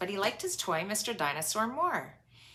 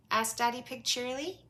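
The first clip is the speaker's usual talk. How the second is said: ordinary delivery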